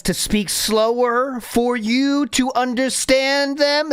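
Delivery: stretching the words out, rising in pitch; slow right down as if for someone who can't follow; exaggerated slowing. Shown as a demonstration.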